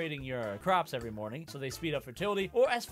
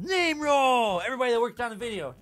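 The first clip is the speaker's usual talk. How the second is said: hype shout; 5 semitones higher, 6 dB louder than usual. Pretend. Hyped credit announcement.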